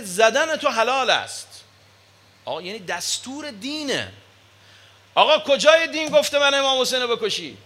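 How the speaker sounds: fiery preacher's crescendo; preaching at full force. Acted. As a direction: build the sermon to a ringing climax. Preacher builds to a climax.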